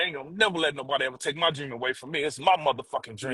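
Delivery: quiet, fast, fierce vow; low determined vow. Retold or imitated. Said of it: "I was like".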